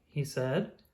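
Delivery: plain reading voice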